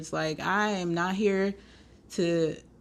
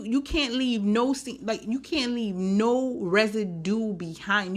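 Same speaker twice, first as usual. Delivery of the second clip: usual speaking voice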